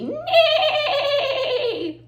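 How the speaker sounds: high, long trembling whinny; whinny high and let it fall at the end; horse neigh. Shown as a demonstration. Teacher playing the horse sound for children, high whinny. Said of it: "every time the horse neighs".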